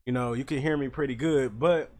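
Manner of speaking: loud, right up on the mic; close to the mic. Shown as a demonstration. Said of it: "if I get close".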